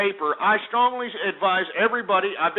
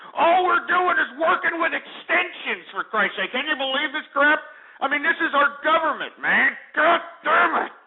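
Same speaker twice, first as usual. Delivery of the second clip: higher, exasperated outburst at the government; real angry rant, pitched up; upset rant; 7 semitones higher than usual. Real, not acted. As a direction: rail against it, fed up and disgusted. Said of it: "I'm sorry if I'm getting so upset".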